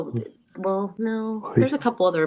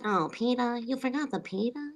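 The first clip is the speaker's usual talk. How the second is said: Lois impression; 4 semitones higher, 7 dB quieter than usual. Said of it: "it'd be like".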